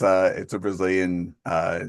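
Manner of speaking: ordinary delivery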